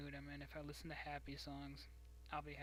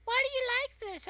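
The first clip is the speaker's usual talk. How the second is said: much higher, louder squeak; high squeaky voice; 14 dB louder, much faster than usual. Retold or imitated. Imitating her high voice for her boyfriend. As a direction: squeak it like a little kid. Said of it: "I'm just like".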